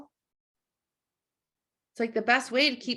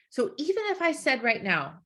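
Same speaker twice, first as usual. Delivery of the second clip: usual speaking voice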